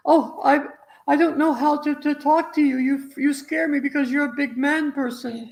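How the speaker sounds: high, timid little voice; mock frightened child. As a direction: play a scared little kid facing a big man. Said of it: "So is fear".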